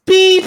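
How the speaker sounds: a high, loud machine beep; short shrill electronic beep sound; imitated beep. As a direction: mimic the lift's beep sharply. Astrolift beeps.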